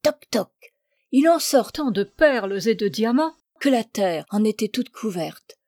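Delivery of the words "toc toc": two high, whispery spoken taps; knocking sound. A narrator's reading. Duchess knocks on a third barrel, imitated knocking. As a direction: imitate knocking with short, airy clicks. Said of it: "un troisième tonneau et cogne".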